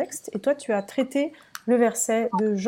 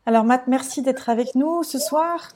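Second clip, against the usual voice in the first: ordinary delivery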